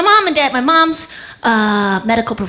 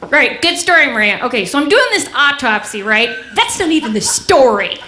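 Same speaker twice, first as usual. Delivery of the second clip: high, emphatic dismissive interruption; sister's brusque takeover; 4 semitones higher, 3 dB louder than usual. Retold or imitated. Sister taking over at dinner table, brusque emphatic interruption. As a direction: cut in and seize the floor. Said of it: "my sister would be at the dinner table".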